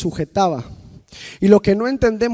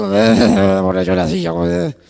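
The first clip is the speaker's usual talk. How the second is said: slow, put-on grumbling voice of a rebel; mock defiant grumble; 5 semitones lower, 4 dB louder, much slower than usual. Preacher mocking a rebellious member, a slow grumble. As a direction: mimic a sulky helper who obeys grudgingly.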